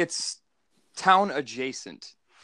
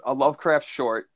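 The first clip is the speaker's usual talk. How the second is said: ordinary delivery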